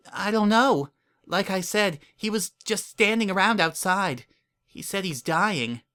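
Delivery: a caring mare coaxing a stranger; kind Earth Pony mayor. A narrator's reading.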